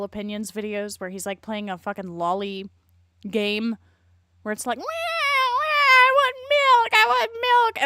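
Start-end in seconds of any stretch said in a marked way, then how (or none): 4.8-7.8 high, drawn-out mock baby cry; hammed-up infant bawling for milk; mock baby wail. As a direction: wail loudly like a demanding baby. Mimics a baby in a game.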